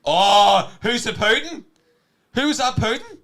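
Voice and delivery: high, very loud teasing yell; hammed-up high-pitched bellow; mock shout. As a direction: holler it as a jeer. Loud teasing outburst about the pudding.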